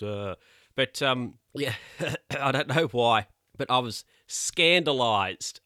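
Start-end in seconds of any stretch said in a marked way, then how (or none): 1.8-2.3 short laugh. Real, not acted. Laughs at own scandal.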